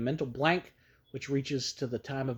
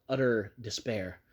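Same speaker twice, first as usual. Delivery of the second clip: ordinary delivery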